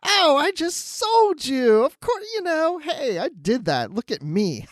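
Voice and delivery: high, slow mock gloating voice; gloating villain. Host mimics the villain gloating at Dal, high and slow. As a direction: imitate the proud villain lording it over him. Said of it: "she's so proud of it".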